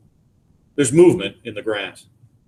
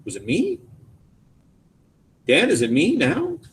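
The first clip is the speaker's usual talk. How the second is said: change of key; 5 semitones higher than usual. A narrator's reading. Son asks Dad if he caused it, pitch rising. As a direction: let the question climb anxiously. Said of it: "another change of key".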